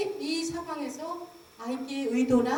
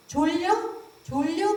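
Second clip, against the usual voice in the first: a lightly voiced mother missing the point; mother asking baby; 6 semitones higher, much slower than usual. Imitating a mother asking her fussing baby if he's sleepy, higher. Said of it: "근데 이 엄마가".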